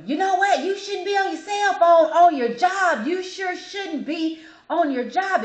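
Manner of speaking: loud, high mock yell; hammed-up shouting customer; imitated yelling rant. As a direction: imitate a ranting drunk customer. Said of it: "he's just yelling".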